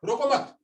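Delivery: high-pitched "रोको मत", no break after "रोको"; "रोको मत" as one unit. Shown as a demonstration. Teacher moving the comma for students, "रोको मत" said together. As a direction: join "मत" straight onto "रोको", then stop. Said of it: "अब यहाँ".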